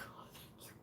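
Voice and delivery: barely voiced whisper of thanks; hushed, acted relief. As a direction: whisper it like a private prayer. Retold relief on finishing exam.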